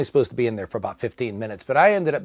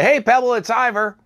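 high, louder call to a patient; cheery greeting; 10 semitones higher, 3 dB louder than usual. Retold or imitated. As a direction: greet brightly, pitched well up. Acts out his cheery greeting.

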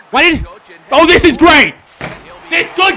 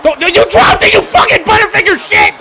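exasperated yell at a clumsy player; heated, higher-pitched scolding; angry shouting; 5 semitones higher than usual. Real, not acted. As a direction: shout the insult in real frustration. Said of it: "I'm so fucking angry".

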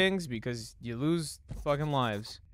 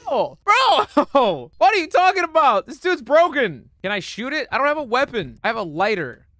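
high, loud yelling; exasperated rant; 10 semitones higher, 9 dB louder than usual. Real, not acted. Player ranting at an overpowered enemy, exasperated. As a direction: protest at the top of your voice.

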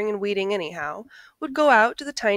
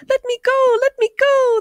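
very high, loud squeaky leprechaun shout; a tiny caught creature yelling to be freed; 9 dB louder than usual. A narrator's reading. Caught leprechaun yelling at Jamie to let go, very high and loud. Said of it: "the leprechaun shouted".

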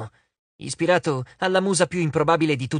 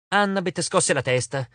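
ordinary delivery, nothing marked

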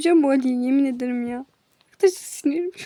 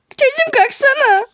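high put-on teacher calling a pupil up; teacher's voice; 11 semitones higher than usual. Mimicking the teacher telling a pupil to get up, high put-on voice. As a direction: play the teacher ordering a kid to stand.

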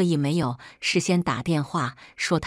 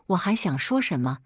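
ordinary delivery, nothing marked

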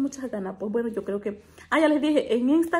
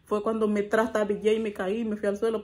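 ordinary delivery

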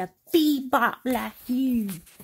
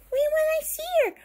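put-on tiny baby voice; 13 semitones higher, 3 dB louder, much faster than usual. Baby toy asks plaintively.

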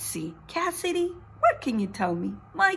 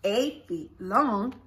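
usual speaking voice

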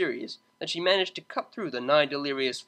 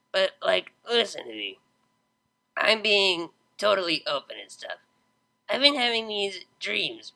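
tipsy Vinyl; 4 semitones higher than usual. Acted. Drunk Vinyl rambling.

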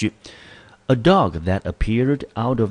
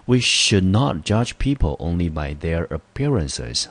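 slow example read; slower than usual. Shown as a demonstration. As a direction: read slowly and clearly, word by word. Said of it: "我们看第一个例句". (慢速清晰地朗读例句)

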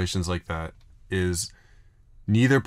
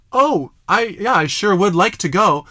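high, louder mock of a stiff, polite yes; robotic politeness; 11 semitones higher, 6 dB louder, slower than usual. Mocking the girl's stiff yes to an awkward invitation, high and loud. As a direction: parrot a forced, robotic acceptance. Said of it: "this robotic exchange".

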